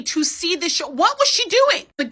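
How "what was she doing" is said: high, loud, incredulous question; baffled outburst. Real, not acted. Recapper baffled by the late mother, incredulous. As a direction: ask it in total disbelief.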